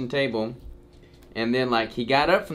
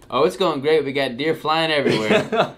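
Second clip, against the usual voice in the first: laughing; 3 semitones higher, 5 dB louder than usual. Retold or imitated. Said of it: "I'm like".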